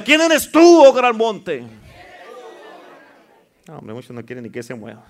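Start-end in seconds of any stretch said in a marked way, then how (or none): 0.0-1.6 loud, high indignant challenge. Shown as a demonstration. Preacher showing the congregation how to confront a mountain, indignant. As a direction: hit "tú" high and hard, fed up with it. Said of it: "dígalo como que ya se indignó de esa montaña".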